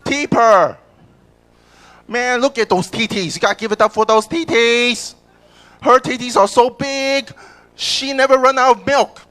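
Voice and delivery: high, loud hype announcer; strip club DJ. Acted. Auditioning DJ introducing a dancer to the club, loudly hyped. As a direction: whip up applause at full volume.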